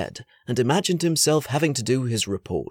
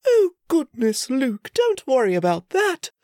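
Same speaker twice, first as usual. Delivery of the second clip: high, soothing motherly voice; gentle mother; 11 semitones higher, 3 dB louder than usual. Acted. Queen promising her son a tray, soothing. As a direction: reassure a sick child.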